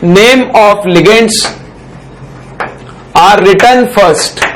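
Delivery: slow, word-by-word dictation; note dictation. Shown as a demonstration. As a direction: read each word out slowly for students to write down. Teacher dictates rule slowly. (धीरे-धीरे notes लिखवाना)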